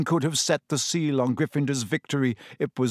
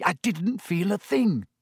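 higher, lightly excited; 5 semitones higher than usual. A narrator's reading. Harry telling Lupin of his success, lightly excited. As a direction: say it with contained excitement. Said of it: "Harry said excitedly".

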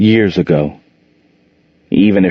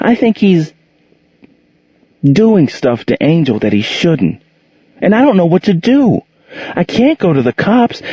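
put-on higher, pleading teenage boy voice, close to tears; distressed boy; 9 semitones higher than usual. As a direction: een radeloze jongen die zijn geheim eindelijk vertelt. Boy confides abuse, distressed.

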